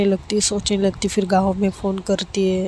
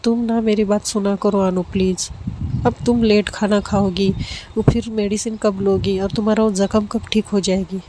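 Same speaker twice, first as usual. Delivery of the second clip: usual speaking voice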